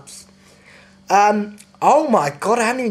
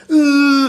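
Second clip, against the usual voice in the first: loud, high "uh" of revulsion; hammed-up gagging wail of disgust; disgusted groan; 9 semitones higher, 8 dB louder than usual. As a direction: groan loudly as if revolted. Retching at chocolate-smeared child.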